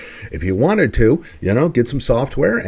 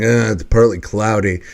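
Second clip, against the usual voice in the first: glum; 2 semitones lower than usual. Retold or imitated. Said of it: "negative interpretation".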